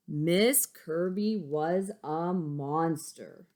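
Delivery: slow, weighty storybook line; slow dramatic narration. Acted. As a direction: lean into each word. Reads picture book aloud.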